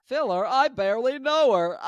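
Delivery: high-pitched incredulous punchline; mock outrage. Vlogger making a pun, high mock-indignant voice. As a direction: deliver the pun high, feigning shock.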